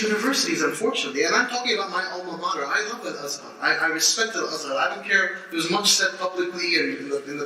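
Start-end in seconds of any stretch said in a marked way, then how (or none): none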